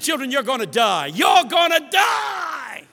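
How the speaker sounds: loud, slow pastor shout; pastor's warning. Retold or imitated. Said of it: "He said".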